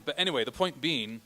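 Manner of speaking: ordinary talk, nothing marked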